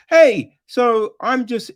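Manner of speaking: high, loud, bubbly mock greeting. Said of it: "they're just like".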